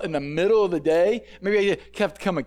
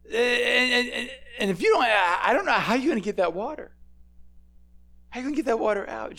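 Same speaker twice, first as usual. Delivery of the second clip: near-tearful worry; 5 semitones higher than usual. Acted. Woman voiced fretting at the well.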